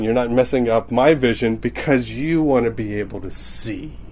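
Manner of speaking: normal talking voice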